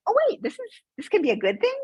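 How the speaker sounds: a high, bright "Oh" of surprise, then a lightly lifted voice; imitating her younger self catching on; sudden realization. Six-year-old self surprised that classmates like her story. Child realizes heritage is good.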